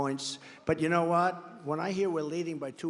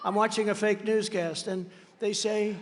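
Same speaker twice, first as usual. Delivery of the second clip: ordinary talk, nothing marked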